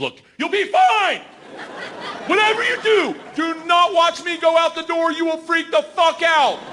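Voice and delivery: high, forceful mock yell over engines. Host warns nervous jumper over plane engines, high insistent yelling. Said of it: "she starts yelling at me".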